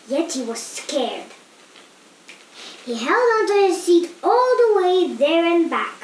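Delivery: ordinary delivery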